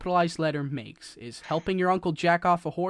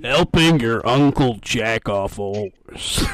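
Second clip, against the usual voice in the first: slow and loud, drawn out; dictating slowly; 6 semitones lower, 10 dB louder, slower than usual. Shown as a demonstration. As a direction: stretch every word out loud. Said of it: "It is capitalization. Look".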